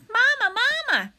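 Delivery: put-on high, loud baby-bird cry; high-pitched little gosling voice; peeping gosling. A narrator's reading. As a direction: call out like a tiny, insistent hatchling. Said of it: "peeped the gosling".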